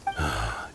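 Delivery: low, soft exasperated sigh; weary, dismissive exhale; bored sigh. Acted. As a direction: sigh like this is beneath you. Thug unimpressed by hero's speech.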